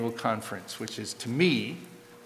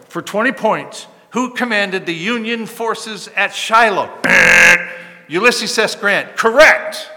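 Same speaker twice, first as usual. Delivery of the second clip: loud, high game show host firing off a question; quiz show host; 7 semitones higher, 11 dB louder than usual. Retold or imitated. Preacher mocking a quiz team with a loud host voice. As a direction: ham up the quiz bowl emcee.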